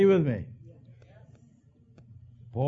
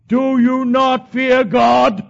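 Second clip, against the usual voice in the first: slow, high, loud half-sung rebuke; thief rebuking; 9 semitones higher, 12 dB louder, much slower than usual. Acted. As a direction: stretch every word out as a sung warning. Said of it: "he said something on that cross".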